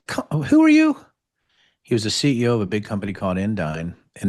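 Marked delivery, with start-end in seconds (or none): 0.1-1.0 high, loud, shocked blurt; startled question. Retold or imitated. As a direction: yelp it out in disbelief. Startled reply to buyout offer.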